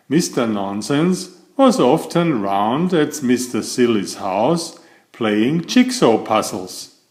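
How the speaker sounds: ordinary delivery, nothing marked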